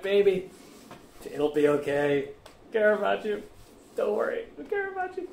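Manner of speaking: high, cooing mock reassurance; mock soothing simp. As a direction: coo like a pushover boyfriend. Mocking soft reassuring boyfriend.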